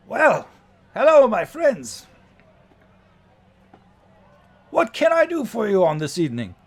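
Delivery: high, loud, hearty greeting; showy coachman welcoming passengers with gusto; big, high-pitched theatrical host voice; jovial warforged coachman. Acted.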